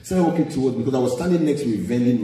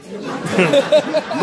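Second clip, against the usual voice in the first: high burst of laughter; 11 semitones higher, 3 dB louder than usual. Real, not acted. Laughing at own story.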